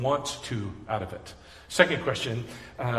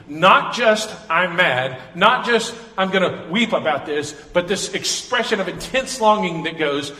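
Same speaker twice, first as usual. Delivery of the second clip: louder, pressing sermon delivery; fiery preaching; 3 semitones higher, 7 dB louder, slower than usual. Acted. Pastor explaining righteous anger to congregation, fiery and loud. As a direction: preach it with full force.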